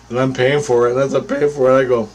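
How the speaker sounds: ordinary delivery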